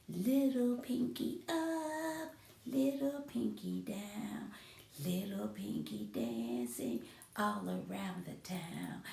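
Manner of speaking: soft, hushed little rhyme for the pinky; tiny and quiet, like a small finger talking. Shown as a demonstration. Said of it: "real quiet pinky voice".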